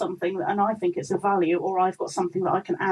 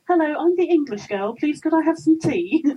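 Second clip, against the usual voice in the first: high, louder polite voice; English girl asking; 7 semitones higher, 5 dB louder than usual. Retold or imitated. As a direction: ask sweetly like the English girl. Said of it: "I had to be like".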